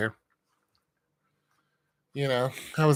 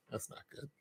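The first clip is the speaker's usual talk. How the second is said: whispered aside; 22 dB quieter than usual. Real, not acted. Muttered aside.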